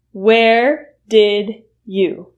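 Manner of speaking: where did you spelled out slowly; slow full form. Shown as a demonstration. Teacher showing the full form slowly. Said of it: "which is the reduction of".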